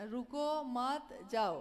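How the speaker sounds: slow रुको मत, then a separate जाओ; words set apart. Shown as a demonstration. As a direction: draw out रुको and मत before जाओ. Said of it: "तीन शब्द है इसमें".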